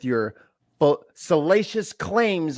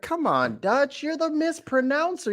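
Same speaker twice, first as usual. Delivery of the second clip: mock him in a high squeaky voice; 10 semitones higher than usual. Mocking teasing of host.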